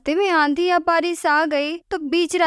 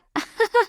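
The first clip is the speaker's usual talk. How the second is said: a high, jeering laugh at Kajri; mocking laugh; 8 semitones higher than usual. Cousins laughing at Kajri's old bike, mocking. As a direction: laugh her poor bike to scorn. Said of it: "सभी हंसने लगती है".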